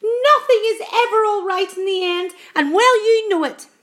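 high, loud doom-mongering; scornful cry. A narrator's reading. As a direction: wail it in bitter indignation. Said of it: "cried the earthworm".

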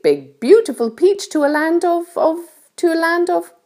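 high-pitched storybook ladybird voice; kindly ladybird. A narrator's reading. Ladybird explains things to James, kindly high voice. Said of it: "obviously a kind and gentle creature".

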